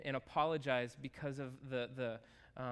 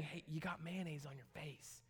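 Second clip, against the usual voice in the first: quiet, throwaway mention of a flaw; a quiet aside, like it barely matters; casual offhand remark; 6 dB quieter than usual. Retold or imitated. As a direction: shallow friend pointing out a flaw, quietly offhand. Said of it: "more than just saying".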